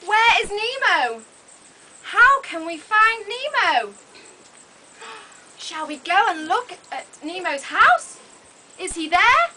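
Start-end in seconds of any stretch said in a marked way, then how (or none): none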